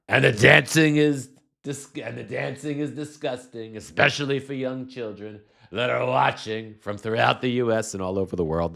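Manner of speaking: Trump voice. Retold or imitated. Host mocking Trump's post to viewers, hammed-up Trump voice. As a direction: sneer it out as Trump. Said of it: "my Trump voice".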